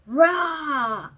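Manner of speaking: loud mock monster roar; beast roar. Beast roaring at Maurice, loud. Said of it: "he roared".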